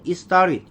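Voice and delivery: "It started" quickly linked as "istarit"; native-speaker pronunciation. Shown as a demonstration. Teacher repeating the native reading for learners, linked and reduced. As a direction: drop the t's in "It started", flap into "i-starid". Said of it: "이렇게 읽어야".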